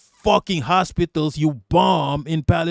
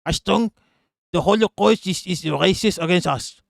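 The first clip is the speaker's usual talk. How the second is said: mock German accent; 3 semitones higher than usual. Streamer retrying a Nazi crying racism, in a German accent. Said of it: "to do a German accent".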